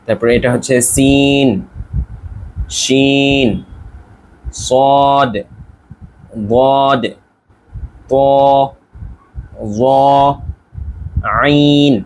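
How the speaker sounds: slow recital of ঝা to আইন; teacher reciting letter names; Arabic letter names. Shown as a demonstration. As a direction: read each Arabic letter slowly, pausing between them. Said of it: "আমি যেভাবে উচ্চারণ করছি".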